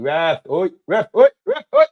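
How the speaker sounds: high, loud appeal to the ref; yelling at the ref. Real, not acted. Fan appealing to the referee, high loud shout. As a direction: bellow for the ref's attention.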